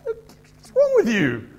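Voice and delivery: high-pitched, loud telling-off; indignant peacemaker. Acted. Peacemaker challenging someone griping about a spouse, indignant scolding. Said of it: "The peacemaker will stand up".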